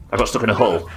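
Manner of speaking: ordinary delivery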